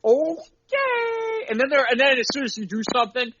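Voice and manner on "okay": high strained okay. Retold or imitated. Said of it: "And you're like".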